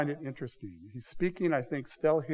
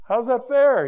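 sharp, much higher and louder protest; indignant grumble; 10 semitones higher, 10 dB louder, slower than usual. Acted. Grumbling worker confronting the master, loud indignant protest. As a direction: hurl it as an outraged complaint.

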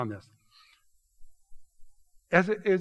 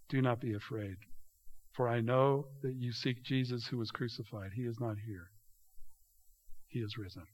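gentle angel's words; 3 semitones lower, 6 dB quieter than usual. A narrator's reading. Angel comforts the women.

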